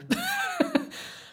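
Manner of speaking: a sudden high, wry laugh. Real, not acted. Laughing at herself while remembering the moment. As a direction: laugh at yourself about the moment it clicked.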